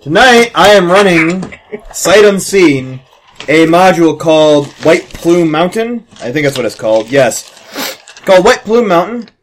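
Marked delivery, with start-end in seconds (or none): 0.0-6.1 slow, dramatic announcer build-up. Acted. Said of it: "dramatic slow announcer intro". 8.1-9.3 title said high in a put-on voice; dramatic announcer. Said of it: "repeats title in put-on voice".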